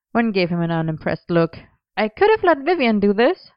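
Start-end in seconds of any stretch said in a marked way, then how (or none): 2.0-3.5 mock threat, a bit higher; threatening. A narrator's reading. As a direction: tease him with a playful warning. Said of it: "she threatened".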